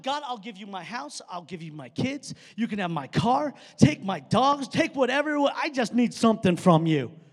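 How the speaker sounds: building to a loud pleading cry; mock desperate plea. Pastor hamming up a desperate plea to God, building loud. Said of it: "That's a joke".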